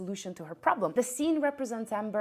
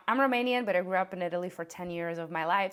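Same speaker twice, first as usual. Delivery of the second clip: normal talking voice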